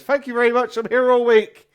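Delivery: loud, high stage farewell; showman's sign-off. Acted. Comedian leaving the stage to applause, showy shout. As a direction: shout your thanks over the applause.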